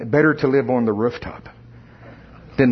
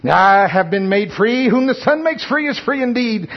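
quoting scripture; 7 semitones higher than usual. Retold or imitated. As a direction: proclaim the verse with growing fervor. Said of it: "I've probably quoted my scriptures again".

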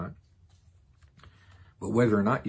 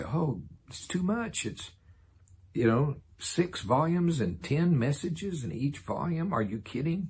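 higher, slow, whiny imitation of a reluctant listener; mock complaining; 7 semitones higher than usual. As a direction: whine it out like it's far too much. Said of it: "People say".

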